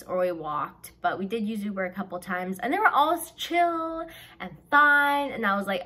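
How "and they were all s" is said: ordinary delivery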